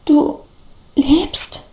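halting, whispered stammer; hushed question broken by a pause; stammering disbelief. A narrator's reading. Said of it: "stammelte der Mann nun".

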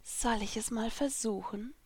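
soft seductive whisper. A narrator's reading. Lucy whispering into Natsu's ear, seductive. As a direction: breathe it into his ear. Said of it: "flüsterte ich".

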